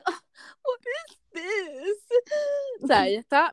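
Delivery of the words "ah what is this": high, slow, frightened gasp of a question; terrified imitation. Friend imitating a terrified contestant, high and slow. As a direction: mimic a scared contestant, drawn out. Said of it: "la imitaste bien brutal".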